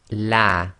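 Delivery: slow, drawn-out "la" as in lamb; light "la" sound. Shown as a demonstration. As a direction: say "la" with an empty mouth and front vowel. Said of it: "as in the English word lamb".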